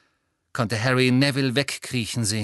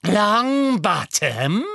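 high, very slow, drawn-out relish; loud, lingering mocking question; Bellatrix; 8 semitones higher, 5 dB louder, much slower than usual. A narrator's reading. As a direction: savour the name like a cruel villain. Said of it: "wiederholte Bellatrix".

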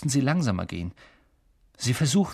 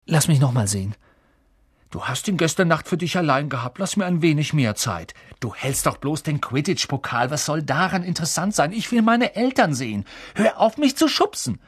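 squabbling; 6 semitones higher than usual. A narrator's reading. Ron and Harry fight over the mirror, squabbling. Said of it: "Streiterei ... wie laut sie sprachen".

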